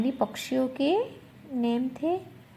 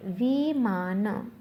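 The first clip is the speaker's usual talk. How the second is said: slow model word; much slower than usual. Shown as a demonstration. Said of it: "क्या बोलते हैं".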